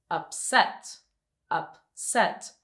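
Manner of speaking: "upset" with the second syllable stressed, rising. Shown as a demonstration. Teacher showing students the stress, "upset" stressed on its second syllable. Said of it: "stress is in that second syllable".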